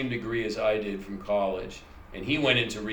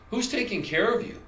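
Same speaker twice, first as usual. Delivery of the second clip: much higher, sing-song nurse voice; nurse's chirpy question; 11 semitones higher than usual. Retold or imitated. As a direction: play the oddly cheerful nurse asking. Nurse voiced in a phone story.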